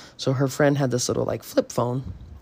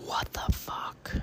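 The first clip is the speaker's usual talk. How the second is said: spooked whisper; 12 dB quieter, slower than usual. Real, not acted. Spooked by the recording.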